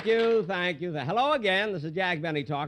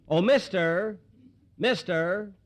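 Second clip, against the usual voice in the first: raised voice calling across the room; 6 semitones higher, 4 dB louder, slower than usual. Real, not acted. Host calling a frowning audience member to the stage, raised voice. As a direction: call him from the front row.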